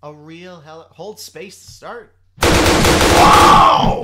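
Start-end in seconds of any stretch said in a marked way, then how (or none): 2.4-4.0 a huge breathy shout of alarm; startled shout at the helicopter; loud shout. Real, not acted. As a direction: yell as the helicopter lurches. Helicopter takes off suddenly.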